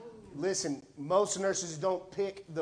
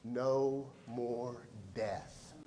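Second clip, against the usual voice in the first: No more death said very slowly, low and soft, each word stretched; drawn-out emphasis; 6 semitones lower, 7 dB quieter, much slower than usual. Acted. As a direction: let No more death sink in, slow and hushed. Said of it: "Listen to this one".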